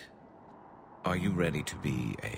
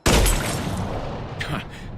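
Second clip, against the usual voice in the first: laugh; 10 dB louder than usual. Acted. Reacts to missing an easy shot.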